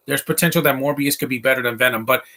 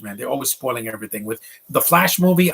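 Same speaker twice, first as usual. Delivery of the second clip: normal talking voice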